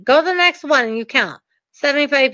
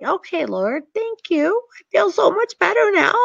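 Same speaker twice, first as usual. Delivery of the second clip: higher, lightly relieved thank-you; 6 semitones higher than usual. Retold or imitated. Speaker thanking God after his reassurance, higher relieved tone. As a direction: sigh of relief put into a lighter voice. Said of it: "So I was like".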